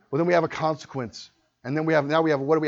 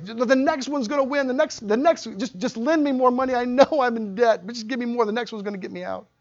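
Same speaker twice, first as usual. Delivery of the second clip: pleading gambler; 7 semitones higher than usual. Retold or imitated.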